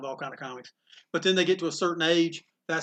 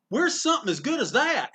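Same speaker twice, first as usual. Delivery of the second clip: put-on high, eager kid asking for more; mock a keen young customer; lightly voiced eager kid; 10 semitones higher, 3 dB louder than usual. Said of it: "they'll come back in and go".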